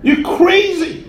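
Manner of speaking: mock screaming crowd. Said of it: "They start screaming at him".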